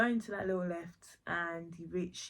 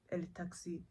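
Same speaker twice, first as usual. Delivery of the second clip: mock Spanish accent; 5 dB quieter than usual. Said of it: "I hated that accent".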